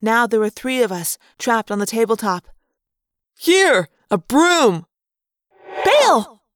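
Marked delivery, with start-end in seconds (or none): 3.4-4.9 loud, higher-pitched shout; calling out. Acted. Boy calls out during rat attack. 5.8-6.4 loud, high one-word yell. A narrator's reading. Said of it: "Rachel yelled".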